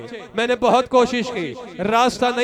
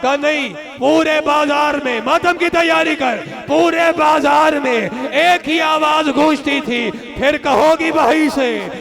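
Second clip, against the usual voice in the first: wailing majlis climax; 3 semitones higher, 4 dB louder than usual. Acted. Preacher driving the mourners to lament, loud and wailing. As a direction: preach the grief at full force.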